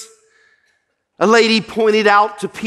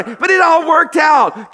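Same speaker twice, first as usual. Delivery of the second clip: triumphant shout; 6 semitones higher than usual. Real, not acted. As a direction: lift it up and shout it to the church. Preacher's triumphant climax line.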